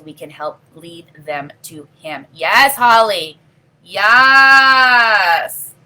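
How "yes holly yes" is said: excited shout. Real, not acted. Answering viewer Holly with an excited shout. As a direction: cheer it out to the commenter.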